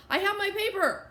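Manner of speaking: high, urgent gasped cry. A narrator's reading. Late student reaches the office door, gasping it out. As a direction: blurt it high, as if just run up. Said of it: "she gasped".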